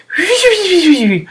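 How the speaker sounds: very high ghostly cry; ghostly wail. Acted. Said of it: "Sam went".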